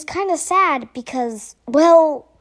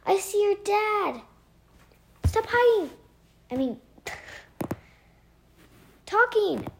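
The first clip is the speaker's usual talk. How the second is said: high, distant warning through fabric; muffled from outside; 5 semitones higher, 4 dB quieter than usual. Acted. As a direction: hush him from outside the bag. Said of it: "Her voice echoed from outside again".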